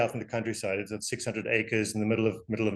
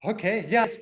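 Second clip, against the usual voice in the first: higher-pitched, mock-compliant voice; mimicked meek agreement; 8 semitones higher than usual. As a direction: go along with it in a higher voice. Mimics the others on the board agreeing.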